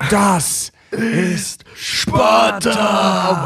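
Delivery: drawn-out, sung shout; movie shout. Retold or imitated. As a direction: bellow it like the film. Quoting the famous movie line.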